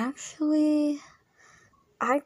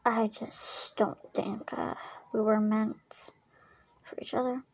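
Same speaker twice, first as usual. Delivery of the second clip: subdued doubt; 2 semitones lower, 7 dB quieter than usual. Acted. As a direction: admit it quietly, with halting pauses. Confiding doubts after a breakup.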